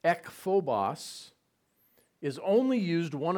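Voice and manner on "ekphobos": ekphobos said slowly and carefully, EK-pho-bos; teaching the Greek word ekphobos, slow and clear. Shown as a demonstration. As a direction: spell out ekphobos syllable by syllable like a teacher. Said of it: "The term there for fear".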